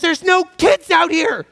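high-pitched shouted retort; angry yell back. Retold or imitated. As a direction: imitate an irate driver yelling back. Other driver yelling back in quarrel.

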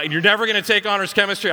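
fast, loud, high imitated telling-off; mock angry teacher piling on punishment; reaming out. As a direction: imitate a teacher's rapid scolding. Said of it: "she reams me".

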